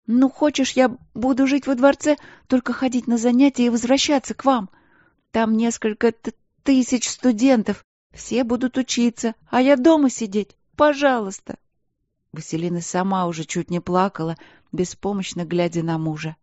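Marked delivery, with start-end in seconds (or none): 0.1-11.6 high, tearful begging; plead, stammering through tears. Acted. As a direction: younger sister begging on the phone to keep studying, pleading. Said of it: "умоляющие нотки".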